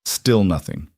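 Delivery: ordinary delivery, nothing marked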